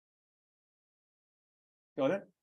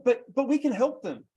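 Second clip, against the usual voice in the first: mock eager salesperson; 7 semitones higher, 5 dB louder than usual. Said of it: "you're going".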